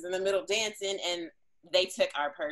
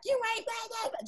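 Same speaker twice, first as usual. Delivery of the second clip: mocking imitation; 14 semitones higher, 4 dB quieter than usual. Imitating girl cursing her out.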